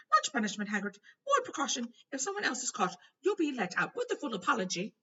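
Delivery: high, loud flustered minister. A narrator's reading. Fudge pleading with Dumbledore, high and loud. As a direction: plead like a harried official. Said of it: "said Fudge".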